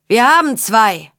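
bellowed shout. A narrator's reading. Warrior reports two captives to his lord, bellowing. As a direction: call it out across the camp. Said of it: "brüllte der mit dem Schwert".

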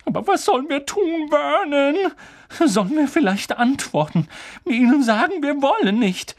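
high-pitched, panicky questions; anxious pleading. Acted. Wife asking husband what to do, high and anxious. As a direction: plead for a plan. Frightened aunt asks what to do.

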